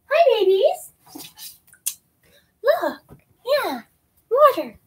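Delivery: high, slow coo at the babies; sing-song pet voice. Real, not acted. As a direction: coo to the little ones. Baby talk to pets.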